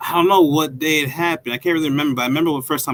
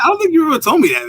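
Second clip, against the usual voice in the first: surprised; 4 dB louder, faster than usual. Real, not acted. Surprised friend never mentioned orchestra.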